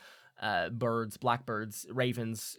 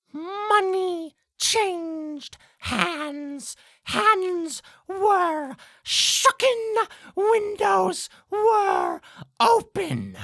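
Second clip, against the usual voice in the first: very high, loud, very slow croak; ominous blackbird; 8 dB louder, much slower than usual. Acted. Blackbird at the player's ear, slow high screech. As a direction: screech it slowly, word by word.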